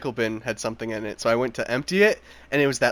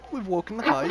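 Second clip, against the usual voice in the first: much higher ghost impression; 8 semitones higher than usual. Imitating the game's ghost for friends, spooky high voice. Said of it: "that's exactly how freaking ghost sounds".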